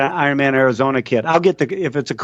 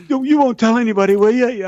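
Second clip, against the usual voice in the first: worried plea; 9 semitones higher than usual. Retold or imitated. Retold rider pleading for secrecy.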